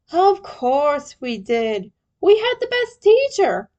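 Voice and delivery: slow then rising high and loud; proud boast. Acted. Leo boasting to Krang, proud and rising. Proud retort about teacher.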